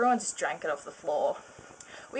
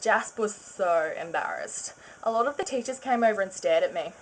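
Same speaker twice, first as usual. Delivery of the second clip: usual speaking voice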